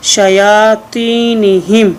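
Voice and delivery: शयातीनिहिम blended slowly in one breath; joined slowly. Shown as a demonstration. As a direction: glide the syllables of शयातीनिहिम together, slowly. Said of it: "जी आप मिला के पढ़ेंगे".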